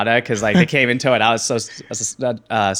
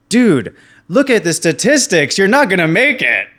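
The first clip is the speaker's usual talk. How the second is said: high, louder know-it-all friend; friend's blunt warning; 9 semitones higher, 8 dB louder than usual. Retold or imitated. Friend telling him to quit college, blunt know-it-all. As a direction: lecture him about the odds. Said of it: "he's like".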